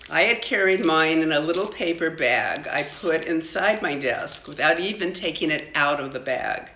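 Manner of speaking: ordinary delivery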